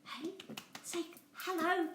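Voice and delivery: high coaxing voice to the toy. Pretend. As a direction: coax him to say hello.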